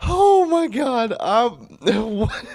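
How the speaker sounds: very high, drawn-out squeal of disbelief; baffled disbelief. Real, not acted. Reader reacting to absurd writing, high baffled squeal. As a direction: squeal it, helplessly bewildered.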